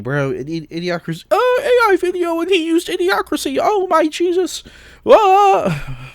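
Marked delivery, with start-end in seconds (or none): 1.3-4.6 very high-pitched mocking squeal; mock hysterical reaction. Ranter imitating viewers of an AI video, mock hysterical. 5.1-6.1 high-pitched mocking wail; mock scream. Ranter imitating a freaked-out viewer, mock screaming.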